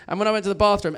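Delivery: ordinary talk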